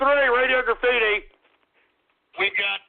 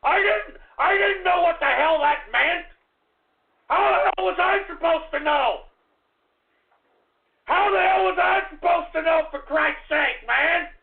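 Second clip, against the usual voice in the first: high, exasperated shouting; indignant protest; 5 semitones higher than usual. Real, not acted. Host defending himself after a prank, indignant shouting. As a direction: shout your innocence in exasperation.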